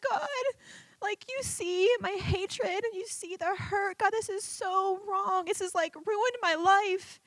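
high, soft, slow tearful prayer; through tears. Retold or imitated. Praying to God about her hatred and hurt, tearful plea. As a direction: plead softly as if close to crying. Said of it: "So through tears, I just said".

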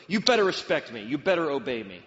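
loud commanding father; stern shout. Retold or imitated. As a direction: bark it like a strict dad. Harsh dad demanding obedience.

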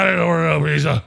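slurred, half-asleep protest; groggy haze. Retold or imitated. Passed-out guest answering the pizza guy, groggy and confused. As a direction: mumble it as if just woken up. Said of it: "I am in a haze".

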